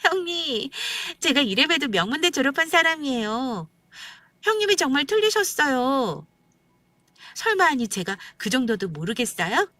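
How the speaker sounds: high, haughty sister-in-law voice; brash know-it-all, high and affected; mocking amusement. A narrator's reading. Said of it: "갑자기 막 웃으면서".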